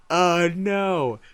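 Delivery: loud, high mock wail; hammed-up fangirl dismay; Tumblr fangirl. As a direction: cry out in exaggerated fan despair. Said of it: "I turned like a Tumblr fangirl".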